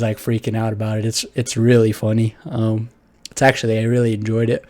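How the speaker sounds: ordinary talk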